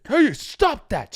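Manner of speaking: mock stern rebuke from a guard; scolding security guard. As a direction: tell off the pranksters like a mall cop. Voicing mall security scolding them.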